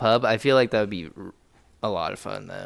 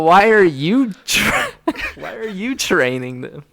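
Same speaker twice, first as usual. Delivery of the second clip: laughing, high-pitched, louder disbelief; incredulous outburst; 8 semitones higher, 6 dB louder, slower than usual. Real, not acted. Co-host challenging the plan to train clone chickens, incredulous. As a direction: blurt it out in amused bafflement.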